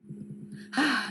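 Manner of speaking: a long, falling tired sigh; Gilly sighs to the doctor before complaining; weary sigh. Acted. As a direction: let out a heavy sigh of weariness. Weary postman sighs before answering.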